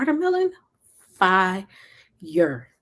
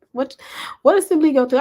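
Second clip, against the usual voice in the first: ordinary talk, nothing marked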